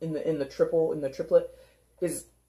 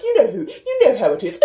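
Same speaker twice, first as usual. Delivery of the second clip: high, loud mock boasting with a laugh; mock name-dropper; 8 semitones higher, 9 dB louder, faster than usual. Reader mocks people bragging about their travels, high loud show-off voice. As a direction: imitate a show-off, much higher and louder.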